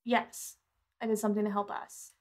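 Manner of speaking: ordinary talk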